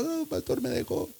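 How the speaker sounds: imitating a crying girl, high and soft; mock tearful wail. Young woman crying to her pastor over a breakup, mock tearful. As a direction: whimper it in a small, high voice. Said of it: "llega llorando".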